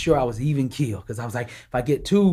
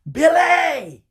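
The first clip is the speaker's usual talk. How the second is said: high loud yell of the name; mock yell; 12 semitones higher, 4 dB louder than usual. Mama yelling at son to hit the bag. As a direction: snap his name like a fed-up mother. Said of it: "She yelled at me again".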